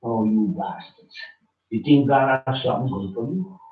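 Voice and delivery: normal talking voice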